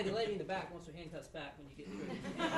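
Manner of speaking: ordinary talk, nothing marked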